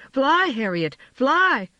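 urgent high cry to fly. A narrator's reading. James urges Harriet to flee, panicked cry.

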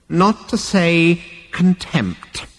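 slow, high, drawn-out disdain; contemptuous old ancestor. A narrator's reading. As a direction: relish the rebuke as a scornful elder. Ancestor continues his rebuke.

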